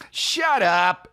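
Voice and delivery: high, drawn-out theatrical retort; hammed-up fifties shut up. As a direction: imitate an actor overdoing a fifties line. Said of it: "he was doing it like".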